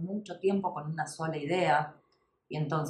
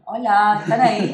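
loud call to the audience; 3 semitones higher, 11 dB louder than usual. Pretend. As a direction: call out to check if anyone's there. Calling out to bored listeners.

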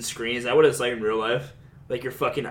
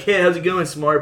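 mock friendly jab; 5 semitones higher, 5 dB louder than usual. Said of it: "the joking, like, jab you".

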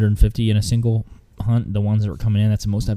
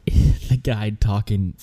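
ordinary talk, nothing marked

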